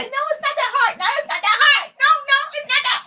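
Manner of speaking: very high, squeaky fake-sweet denial; hammed-up high dismissive voice; mock reassurance. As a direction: mimic a falsely reassuring person in falsetto. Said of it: "I'm not one of those people that say".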